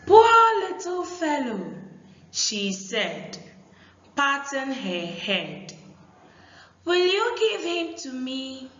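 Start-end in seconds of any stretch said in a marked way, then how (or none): 0.1-1.7 put-on high, loud, tender girl's voice. Aku pities the wet dog in a high girl's voice. Girl voiced in read story. 6.9-8.7 put-on quick, high girl's voice; girl asking. Aku asks the boys for the dog in a high, quick girl's voice.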